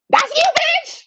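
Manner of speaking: high, loud hype shout; excited shout. Real, not acted. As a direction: yell it like you're cheering a friend on. Hyping the finalist with a callout.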